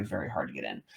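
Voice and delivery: low, soft, trailing emphasis; quiet. Real, not acted. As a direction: drop the voice right down. Repeats phrase, drops low.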